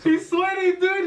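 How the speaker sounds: laughing. Real, not acted. Friend sweating from hot wings.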